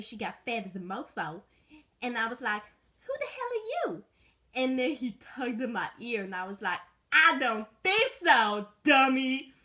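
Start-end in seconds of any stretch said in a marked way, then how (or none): 3.0-4.0 high, squeaky little dog. Acted. Little dog snapping at a stranger, high and squeaky. Dog voiced in own story. 7.1-9.5 loud defiant. Acted. Dog defying the owner.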